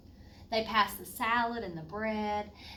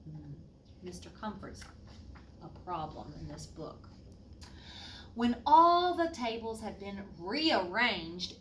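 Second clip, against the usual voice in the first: plain reading voice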